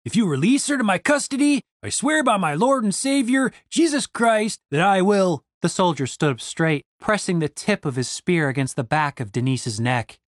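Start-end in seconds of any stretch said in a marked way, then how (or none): 0.0-5.4 earnest vow. Acted. Randy swearing earnestly.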